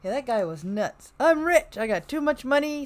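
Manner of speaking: normal talking voice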